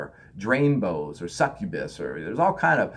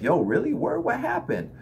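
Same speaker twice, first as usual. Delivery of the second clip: attentive listener; 8 semitones higher than usual. Retold or imitated. Listening to a friend's trouble, higher concerned asking. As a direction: ask as a caring friend leaning in. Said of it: "perform listen and be like".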